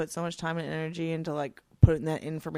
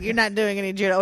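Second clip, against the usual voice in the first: louder mock nagging from mom; imitated scolding mother; 3 semitones higher, 9 dB louder, faster than usual. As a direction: scold like a mother, half-yelled. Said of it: "she yelled at me".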